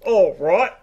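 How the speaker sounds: lightly put-on Aussie accent; mock Australian accent. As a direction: play along in Australian. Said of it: "it sounds Australian".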